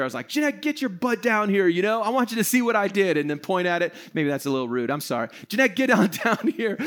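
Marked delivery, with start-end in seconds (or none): 0.3-3.2 higher-pitched mock call to the wife; mock yell upstairs. Husband pretends to summon his wife from downstairs, mock yelling. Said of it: "I yell upstairs at her". 5.5-6.8 higher mock holler for the wife; mock yell upstairs. Husband jokingly calls his wife down after apologizing, mock yelling. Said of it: "I yell upstairs at her".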